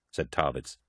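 usual speaking voice

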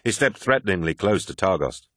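usual speaking voice

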